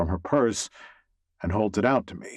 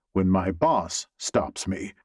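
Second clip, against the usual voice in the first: ordinary delivery, nothing marked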